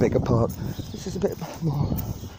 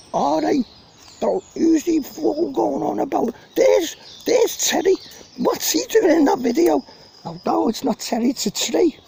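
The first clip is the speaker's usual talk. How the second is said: put-on Scouse voice; 14 semitones higher, 3 dB louder than usual. Mock mate in the video, hammed-up Scouse. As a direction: ham up a thick Scouse character. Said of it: "started off all right, Scouse".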